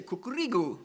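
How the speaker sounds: cucurigu said koo-koo-ree-goo; Romanian rooster call. Shown as a demonstration. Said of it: "In Romania, they say".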